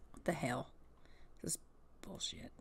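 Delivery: hushed unvoiced curse; whispered grumble. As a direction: mutter it under your breath. Baby grumbling aside.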